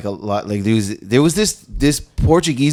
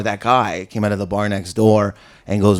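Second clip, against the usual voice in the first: normal talking voice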